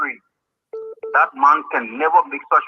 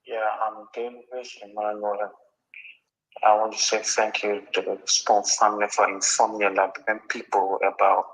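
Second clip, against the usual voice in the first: phone held away; 4 semitones lower, 5 dB quieter than usual. Real, not acted. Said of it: "you talk into the phone".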